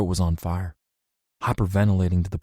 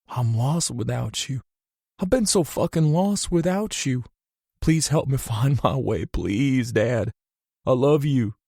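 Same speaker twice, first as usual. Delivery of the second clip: high, breaking with emotion; crying plea; 5 semitones higher than usual. Acted. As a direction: plead through tears. Crying over his father.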